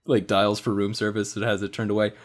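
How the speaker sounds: ordinary talk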